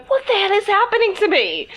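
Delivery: high, loud panicked what the hell; bewildered. Retold or imitated. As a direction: squeak it out in confused panic. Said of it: "you'll be in the middle of it going".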